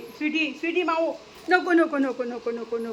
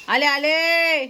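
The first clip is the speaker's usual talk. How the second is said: louder, drawn-out call back; real call across the house; 3 semitones higher, 7 dB louder, much slower than usual. Real, not acted. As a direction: holler that you're on your way. Calls out she is coming.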